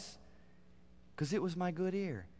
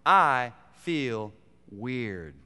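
very slow, louder "I feel weird"; drawn out; 3 semitones lower, 6 dB louder, much slower than usual. Real, not acted. Preacher confessing his struggle, very slow. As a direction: stretch every word.

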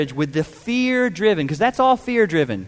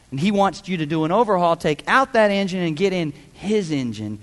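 ordinary talk

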